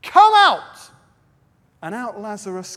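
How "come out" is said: loud high commanding shout. Acted. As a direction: call Lazarus out of the tomb at full voice. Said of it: "He shouted".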